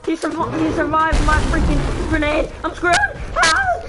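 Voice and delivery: loud, high shout in panic; panicked gaming yell. Real, not acted. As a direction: scream as the boss survives the grenade. Grenade fails, gamer panics.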